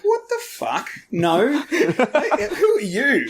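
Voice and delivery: high, laughing disbelief at the request; what the hell, who is this guy; shocked disbelief. Retold or imitated. Telling a stranger's odd request, shocked and laughing. Retold shocked reaction on set.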